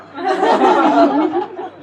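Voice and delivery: big audience laugh; crowd laughter. Real, not acted. Audience reacts to punchline.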